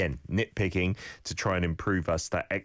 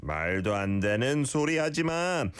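normal talking voice

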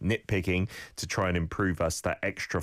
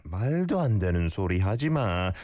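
말도 안 되는 소리 하지 마 in one quick sweep; 말도 안 되는 소리 하지 마 said as one joined unit; connected phrase; much faster than usual. Shown as a demonstration. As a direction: link 되는 straight into 소리 without a gap. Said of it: "Not too much of a gap".

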